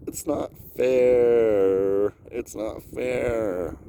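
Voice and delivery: drawn-out slow complaint; slow whining. Real, not acted. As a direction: stretch every word, sulking.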